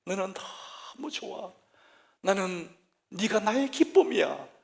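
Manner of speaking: slow, lifted and fond; 느리고 높게, 다정한 아버지 목소리로; God's tender voice. Acted. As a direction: speak it like a father delighting in his child. Voicing God's loving words.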